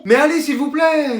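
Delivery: high, drawn-out begging; wheedling, stretched-out plea; pleading child. Acted. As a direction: whine for it like a kid. Child begs parents for a console.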